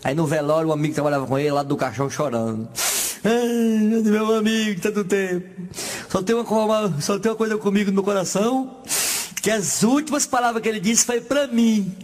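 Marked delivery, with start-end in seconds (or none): 3.2-11.9 mock weeping mourner.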